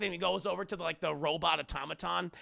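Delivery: ordinary talk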